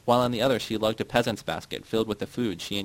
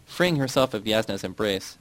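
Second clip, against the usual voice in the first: usual speaking voice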